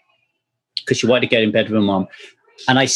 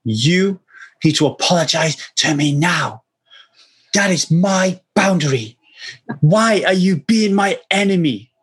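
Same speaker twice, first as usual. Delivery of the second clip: put-on higher, indignant little-girl voice; four-year-old's demand; 4 semitones higher than usual. Father imitating his furious four-year-old's demand, pitched higher. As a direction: play the small child laying down the law. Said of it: "she jabs her finger in my chest and she says".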